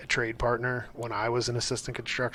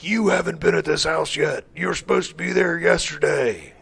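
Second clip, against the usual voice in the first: mock scolding, higher and louder; 4 semitones higher, 6 dB louder than usual. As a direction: chew him out on the phone. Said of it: "I was like".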